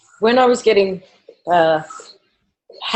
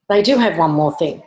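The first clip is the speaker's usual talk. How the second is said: ordinary talk, nothing marked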